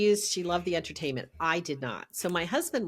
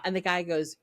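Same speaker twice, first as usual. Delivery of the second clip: ordinary talk, nothing marked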